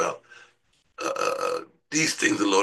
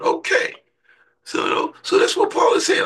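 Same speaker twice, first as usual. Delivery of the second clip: full-force preaching. Acted.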